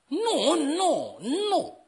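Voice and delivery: high, drawn-out "no"s; emphatic refusal. Acted. Preacher rejects Christians' talk of lost battle, high emphatic protest.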